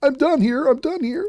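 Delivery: high, sing-song mocking of the host; mock huffy. Commentator parodies the host quitting, high mocking voice. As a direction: parody him storming off. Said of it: "See that? He's done".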